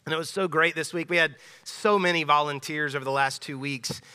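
usual speaking voice

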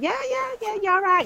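high-pitched, rapid-fire hello back; imitated breezy greeting. Mancunians greeting each other, imitated breezy hello. Acting out a Manchester greeting exchange.

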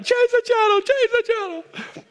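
put-on very high little-girl voice pleading; daughter's plea. Daughter begs dad to change the TV channel, high and pleading. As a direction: squeak it out like a begging little daughter. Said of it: "My daughter's like".

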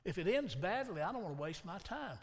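ordinary delivery

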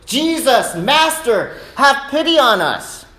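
high, pleading call; loud calling out. Acted. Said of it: "called out in a lo-, loud voice".

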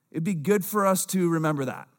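ordinary talk, nothing marked

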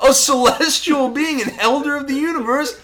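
high, loud laughing outrage; exasperated Collector. Retold or imitated. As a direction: shriek it out in laughing disbelief.

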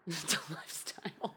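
soft, laughing repeat; laughing echo. Real, not acted. Co-host teasing a league fan's devotion, laughing. Laughing while echoing co-host's remark.

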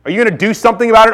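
high, urgent acted appeal; pleading with God. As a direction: cry out to God for an answer. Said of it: "we just wanna say".

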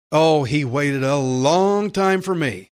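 usual speaking voice

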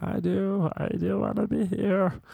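put-on high meek girl voice; timid girl. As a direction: answer like an eager scared student. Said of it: "the girl was like".

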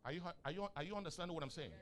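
normal talking voice

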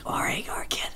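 imitated drunk man's whisper. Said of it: "what are you whispering for".

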